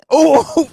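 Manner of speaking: high, loud "oh!"; startled yelp. Real, not acted. Host catching himself swearing on air, startled yelp. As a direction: yelp at your own slip.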